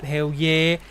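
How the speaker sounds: loud, punchy cheer; triumphant cheer. Real, not acted. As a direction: cheer the win loudly. Celebrating farm.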